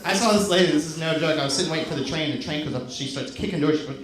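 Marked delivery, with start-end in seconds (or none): none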